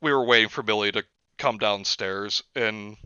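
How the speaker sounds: ordinary delivery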